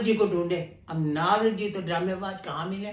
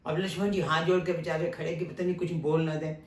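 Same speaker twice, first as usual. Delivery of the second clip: ordinary talk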